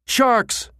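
a high, sudden alarm shout; yell. A narrator's reading. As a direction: yell out a warning across the room. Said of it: "An ear-splitting yell".

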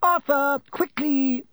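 high, loud cry to hurry; urgent cry. A narrator's reading. Wife calling husband as train moves, urgent cry. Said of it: "cried Missus Weasley".

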